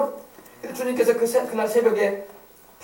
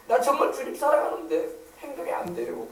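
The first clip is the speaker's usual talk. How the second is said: softer, higher, mildly tearful plea; 5 semitones higher, 5 dB quieter than usual. Acted. Peter telling the Lord he loves him, tearful. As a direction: confess it as if choking up a little. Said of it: "베드로가 울먹이는 거야".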